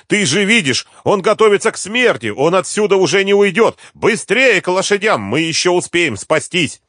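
high, loud, insistent pleading; urgent shout. A narrator's reading. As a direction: shout him toward escape. Urging him to flee. (настойчиво кричит, торопя)

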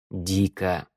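soft, tired low sigh of a word; weary. A narrator's reading. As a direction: say «Дико» softly, worn out. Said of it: "сказал устало змеевик". (устало, тихо)

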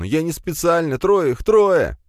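high, loud, slow whimpering; whining plea. Acted. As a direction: blurt it out in terror. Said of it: "законючил он".

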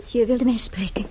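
breathy whisper, discreet; hushed whisper. Acted. Chambermaid to the guest in the porter's office, whispering. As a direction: whisper it so nobody overhears. Chambermaid arrives for secret meeting.

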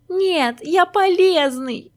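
выше и громче, медленно, с плачем; сорвись на плаксивый крик; плача. A narrator's reading. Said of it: "заплакал Маськин". (Маськин в слезах кричит пальме)

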